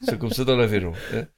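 put-on low Aveyron accent sliding into Russian; Aveyron turning Russian. Inviting folk for borsch in Moscow, Aveyron accent gone Russian. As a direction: let the low country accent drift into mock Russian. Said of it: "Il devient russe".

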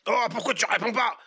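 high, loud exasperated elf voice with a laugh in it; annoyed elf. Acted. Elf scolding his motionless colleague, high and loud. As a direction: shake him and demand an answer, half laughing. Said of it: "s'énerva Monsieur Tambourine Man".

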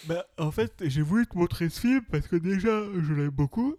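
talking through a yawn. Real, not acted. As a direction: stretch the words out mid-yawn, tired. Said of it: "je baille parce que je suis fatigué".

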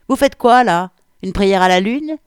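put-on loud, high-pitched woman with a Landes accent. A narrator's reading. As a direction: call out sharply in a southwestern French Landes accent. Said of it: "voix de femme dotée d'un accent landais carabiné". (accent landais carabiné, voix de femme forte et aiguë)

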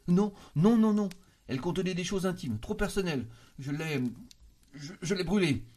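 high, loud panicky denial; cornered, high-pitched protest with halting end; flustered refusal. A narrator's reading. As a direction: blurt out refusals, then stumble into the excuse. Cousin lies, refusing to show letter.

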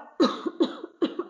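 sudden choking cough from swallowing wrong; coughing fit. Real, not acted. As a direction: cough hard, caught off guard. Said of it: "pardon, j'avale de travers".

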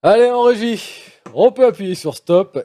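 consigne lancée fort et haut; appel à la régie. Real, not acted. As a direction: interpelle l'équipe technique d'une voix qui porte. Animateur interpelle la régie. (consigne criée à la régie)

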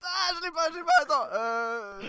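paniqué, très aigu et fort; surpris. Real, not acted. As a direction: pris de court, la voix qui monte. Pris au dépourvu pour l'imitation. (surprise paniquée, très aiguë)

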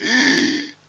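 loud gasping inhale at phrase end; imitating a heavy breathing singer; Darth Vader breath. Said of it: "le mec, il fait".

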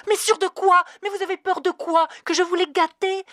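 loud, high, furious questions; about to explode; near apoplexy. Acted. Dana snapping at the clerk, near apoplexy. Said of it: "au bord de l'apoplexie".

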